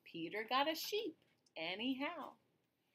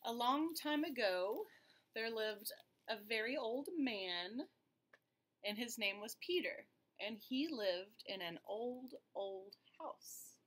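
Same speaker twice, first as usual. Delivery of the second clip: put-on lively read-aloud voice for kids; story narration; 2 semitones higher than usual. Teacher reading a picture book to children, storytelling voice. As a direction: tell it like bedtime storytime.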